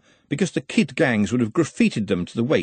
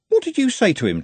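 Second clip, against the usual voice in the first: put-on surprised young lad; 11 semitones higher than usual. Trev asks, surprised.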